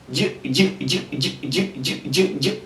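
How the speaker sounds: very soft short J, no vowel; bare consonant J. Shown as a demonstration. Teacher drilling the class on a very soft J. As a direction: barely breathe out J, no vowel. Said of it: "you pronounce it as what?".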